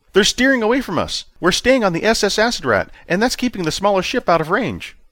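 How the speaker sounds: officer reporting to his commander, high and tense; nervous junior officer. A narrator's reading. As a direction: report urgently like a rattled subordinate.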